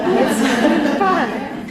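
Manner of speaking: embarrassed laugh, high; nervous laugh. Real, not acted. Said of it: "never been like this before".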